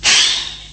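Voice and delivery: one big breathy sigh of giving up; a deep defeated exhale; heavy sigh. A narrator's reading. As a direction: let the anger out in a long sigh. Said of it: "ถอนใจเฮือก".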